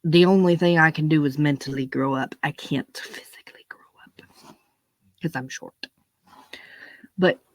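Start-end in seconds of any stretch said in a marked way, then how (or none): 2.4-5.7 soft conspiratorial whisper; whispered aside. Acted. Comic confessing his height to the audience, whispered aside. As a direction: drop to a hush for the punchline.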